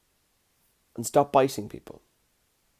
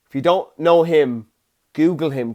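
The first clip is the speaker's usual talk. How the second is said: ordinary talk, nothing marked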